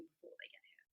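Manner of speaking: soft unvoiced whisper. Real, not acted. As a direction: drop to a whisper. Hopeful aside, trailing off.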